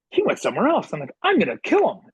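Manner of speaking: high, loud comic outburst; exasperated mock rage. Coach fuming about the player who left, high and loud. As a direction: boil over in comic fury.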